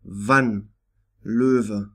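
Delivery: Wann and Löwe with W said as V. Shown as a demonstration. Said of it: "pronounced like the letter V in English".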